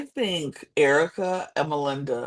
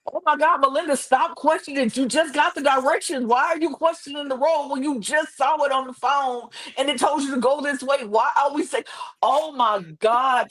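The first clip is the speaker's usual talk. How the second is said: exasperated yelling; 10 semitones higher than usual. Retold or imitated. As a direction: plead with the TV in exasperation. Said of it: "I was yelling".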